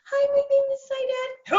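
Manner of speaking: very high, squeaky self-mockery; mousy phone voice. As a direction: pipe up like a frightened mouse selling itself. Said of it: "I literally sound like a mouse".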